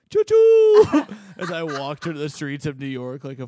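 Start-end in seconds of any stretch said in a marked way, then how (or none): 0.1-1.1 "choo choo" shouted very high and loud. Retold or imitated. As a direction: belt out "choo choo" like a psycho. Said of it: "I was just going".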